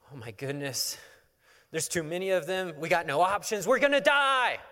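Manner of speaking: building from stunned to a high, loud wail; hammed-up rising panic, ending in a yell; panicking. Servant seeing an army at his door, building panic. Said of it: "he just loses all of that".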